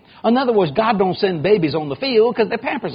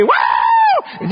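rowdy whoop; 13 semitones higher than usual. Retold or imitated. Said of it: "reliving the party".

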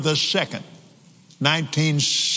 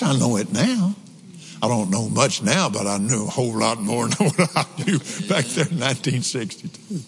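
normal talking voice

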